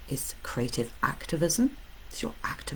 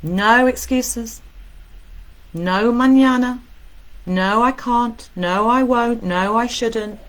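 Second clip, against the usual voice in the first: loud, high and drawn-out; emphatic proclamation; 7 semitones higher, 7 dB louder, slower than usual. Real, not acted. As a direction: declare each "no" slowly and forcefully. Proclaiming the sixth rule.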